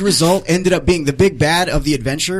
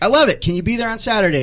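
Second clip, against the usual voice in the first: high, eager retold outburst; enthusiastic reply; 8 semitones higher, faster than usual. Organizer accepting the Scrooge audition, enthusiastic.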